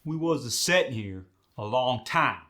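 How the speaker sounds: Midwest Farm Belt accent, added syllables; Midwest farm drawl. Shown as a demonstration. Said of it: "See how I added them A's".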